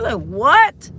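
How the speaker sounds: high-pitched, rising disbelief; incredulous. Retold or imitated. Retelling to viewers her reaction to mom's news, incredulous. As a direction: re-enact shocked questioning, pitch leaping up.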